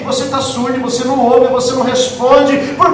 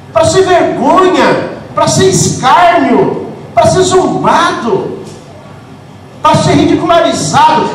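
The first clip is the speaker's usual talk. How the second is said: cada "pra ser" bem devagar e pesado; lenta e enfática; 2 semitones higher, slower than usual. Real, not acted. Preacher's slow emphatic list. (pregação lenta e pesada, frase por frase)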